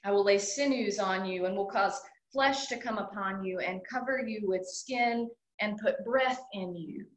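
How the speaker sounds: ordinary delivery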